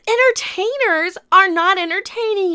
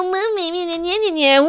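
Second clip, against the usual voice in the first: drawn-out nyah-nyah mockery; sneering, stretched-out parody of the reader; mocking singsong; much slower than usual. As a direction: smear the word into mocking baby babble. Mocks the article she reads.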